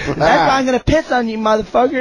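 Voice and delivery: half-laughing take on a friend's tirade; imitated angry yelling. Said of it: "he's yelling at the cab driver".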